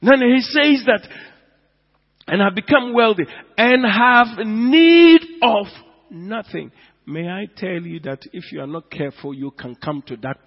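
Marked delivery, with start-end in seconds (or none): 0.0-6.7 loud, high, slow verse reading; full-force preaching. Acted.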